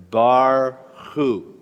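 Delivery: "Barhu" drawn out as two separate syllables; syllable-by-syllable reading. Shown as a demonstration. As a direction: lead the class slowly through "Barhu", bar then hu. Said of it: "Can we all read the Hebrew together?".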